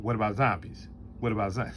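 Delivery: mimicking the humorless friend's flat question; imitating Rob's deadpan. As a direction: drone it twice like someone with no delivery. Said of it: "Or as Rob said".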